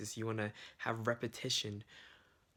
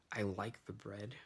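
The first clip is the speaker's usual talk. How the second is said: ordinary delivery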